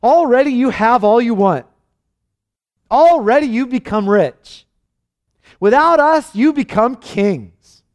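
loud, mocking sarcasm, slowing on the kings; Paul mocking the proud church, loud and sarcastic. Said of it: "So Paul says sarcastically".

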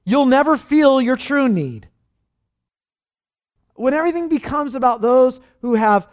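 loud, high, driving sermon voice; rising pulpit fervor; full-force preaching. Acted. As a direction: preach it hard to the congregation.

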